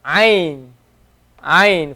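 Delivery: ain from deep in the throat; teacher drawing out the throat sound ain; Arabic ain. Shown as a demonstration. Teacher demonstrates the letter name ain. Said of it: "It must make that sound".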